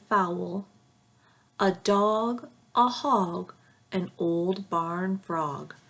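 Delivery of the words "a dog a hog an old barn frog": usual speaking voice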